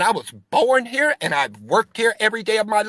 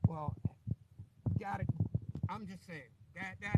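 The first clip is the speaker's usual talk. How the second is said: muffled, nearly inaudible phone line; far-off, muffled voice; 21 dB quieter than usual. Real, not acted. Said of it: "You're muffled. I can't hear you".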